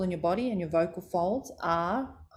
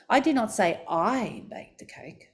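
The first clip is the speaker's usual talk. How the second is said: second "I" raised and drawn out; stress on second I; 3 semitones higher than usual. Shown as a demonstration. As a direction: lean slowly on the "I" before "baked". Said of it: "you can even stress words".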